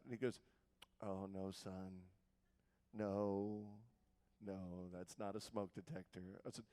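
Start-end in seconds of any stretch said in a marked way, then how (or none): 1.0-6.2 soft, low, unhurried dad; hushed, low voice of a father; dad's voice. Retold or imitated. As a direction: quietly correct your son, pitched down. Voicing dad in a story.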